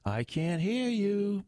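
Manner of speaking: high, slow MC screech. A narrator's reading. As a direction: goad the crowd with a stretched, high call. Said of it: "the M C screeched".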